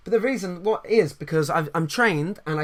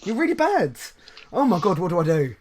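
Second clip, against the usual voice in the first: mock shocked reaction; 3 dB louder than usual. Imitating shocked gym staff.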